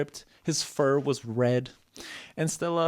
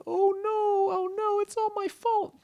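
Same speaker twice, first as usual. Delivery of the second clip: very high, wailing squeak; hammed-up tearful squeal; crying Stella. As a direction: lament in a tiny high voice, guilt-ridden. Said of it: "And Stella was crying".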